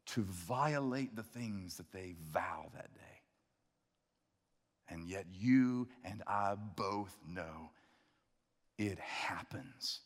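hushed, grave whisper. Real, not acted. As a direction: drop to a whisper. Grave point dropped to whisper.

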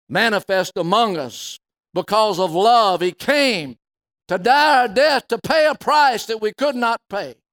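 a Sunday-night sermon at full volume; full-force preaching. Acted. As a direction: preach it out to the back row.

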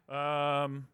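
normal talking voice

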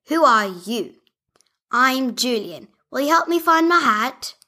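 a hint of a sad little builder; Julian's voice. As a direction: Julian the builder lamenting his hat. Julian character voice.

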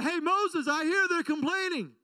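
a hint of God calling out. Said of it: "God said".

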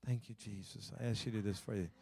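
soft, meek little prayer; hushed and polite, asking nicely. Acted. A new believer praying softly and politely to Jesus.

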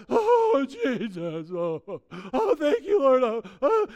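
imitating loud, high, tearful crying out to Jesus; a hammed-up weeping worshipper at church; mock sobbing worshipper. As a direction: sob and cry out like someone overcome in worship. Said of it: "they're like".

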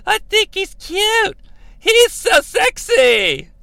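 high, drawn-out mock diva delivery; hammed-up slow swooning; Sherry Martel. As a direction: stretch every word in a put-on high voice. Imitates Sherry's song lines.

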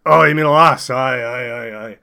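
broad Scots character voice; Scottish accent. Acted. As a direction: lay on the Scottish brogue. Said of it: "dislike the character voices".

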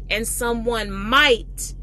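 slowed-down emphasis on might; stress on might. Shown as a demonstration. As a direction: lean hard on might, slowly. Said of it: "emphasizing the word might there".

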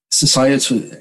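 normal talking voice

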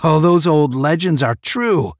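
high, loud astonishment; gasped amazement. A narrator's reading. Moran seeing the legend is real, gasping. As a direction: exclaim it in awe. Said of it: "Moran gasped".

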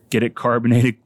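ordinary delivery

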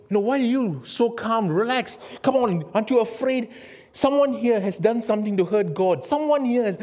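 high, loud, urgent confrontation; alarmed sailors. Acted. Sailors rounding on Jonah in the storm, alarmed.

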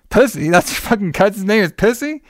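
mock shocked disbelief; disbelieving echo. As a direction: react like you can't believe the name. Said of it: "I was like".

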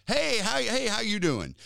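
a light, high cheery hello; mild friendly greeter. Retold or imitated. Security head greeting strangers, lightly high and cheery. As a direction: toss off a sunny hey to a stranger. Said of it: "he's just".

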